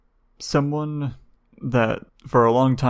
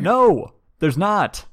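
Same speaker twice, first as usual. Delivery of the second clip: playful no; 9 semitones higher, 3 dB louder, much slower than usual. Real, not acted. Teasing reply to the cohost.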